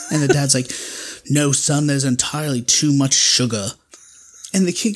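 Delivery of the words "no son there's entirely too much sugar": imitated British dad; British accent. British dad refusing his son candy, British accent. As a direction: refuse firmly as a British father. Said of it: "That was my British accent".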